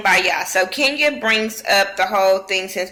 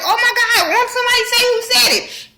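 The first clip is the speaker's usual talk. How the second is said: much higher, giggling plea; laughing squeal. Retold or imitated. Table giggling, dying for gossip.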